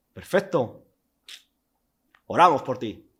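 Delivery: breezy, dismissive "Perfecto. Oramos por ti" tossed off lightly; a hint of glib dismissal on "Oramos por ti"; mock easy reply. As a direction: lightly brush her off with a quick blessing. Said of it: "Eso es lo fácil".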